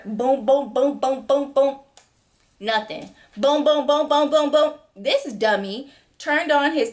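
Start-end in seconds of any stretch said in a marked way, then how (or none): 0.0-4.7 loud boom boom knocking; imitated door banging. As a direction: pound the door with your voice.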